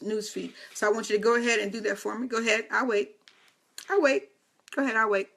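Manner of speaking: ordinary talk, nothing marked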